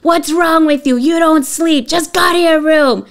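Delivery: high, loud exasperated mother yelling; angry scolding. Retold or imitated. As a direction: snap at the child in a mock tirade. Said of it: "if I would have said".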